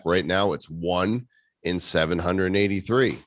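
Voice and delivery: usual speaking voice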